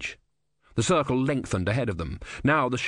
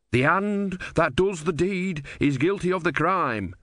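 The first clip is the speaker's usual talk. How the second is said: higher, slow recitation; Brother quoting scripture; 7 semitones higher than usual. A narrator's reading. Brother citing holy text at the sailors, slow. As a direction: recite it like a verse.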